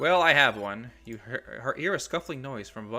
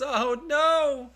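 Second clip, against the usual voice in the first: much higher and louder, in disbelief; shocked outcry; 12 semitones higher, 10 dB louder, much slower than usual. Real, not acted. Player reacting to the Wisp's sacrifice, shocked high outcry. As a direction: cry out as the ally is lost.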